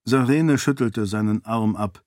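ordinary delivery

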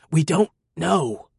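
strained rasp, slow. Acted. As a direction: choke the words out. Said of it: "Ross rasped out".

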